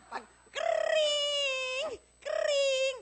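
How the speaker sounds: high shouted "kring, kring" like a ringing phone; imitated phone ring. Preacher mimicking the phone ringing for the congregation. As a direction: ring like a telephone. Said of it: "phone ringing imitated".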